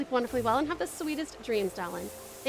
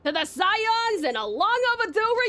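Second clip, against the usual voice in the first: higher, louder hearty toast ending in a shout; Hoary Boulder; 8 semitones higher, 5 dB louder, slower than usual. Acted. Gruff friend toasting the Scions, loud hearty cheer. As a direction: raise a mug and cheer the reunion. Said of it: "Good ol' Hoary Boulder".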